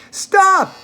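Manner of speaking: Charlie's high, loud cry of no; shout. A narrator's reading. Charlie shouting at Mr Wonka to stop, high and loud. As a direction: yell it out to stop him. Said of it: "shouted Charlie".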